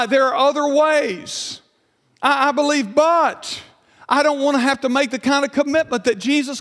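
high, insistent preacher's mimicry; mock pleading excuses from the pulpit; imitated excuse-maker. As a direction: protest like a reluctant believer, pitched well up. Preacher voices people making excuses.

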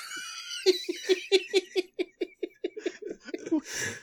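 long helpless giggle; high wheezing laughter. Real, not acted. Friend cackles helplessly at own fart joke.

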